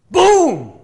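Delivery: huge high shouted boom; hyped-up pastor. Acted. Pastor leading excited kids in a chant, big shout. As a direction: explode on one high, loud word. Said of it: "And he goes".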